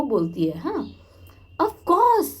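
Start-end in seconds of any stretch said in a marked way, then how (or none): 1.6-2.3 high, loud tearful of course; sniffing. Acted. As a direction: blurt it through tears. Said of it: "I sniffed".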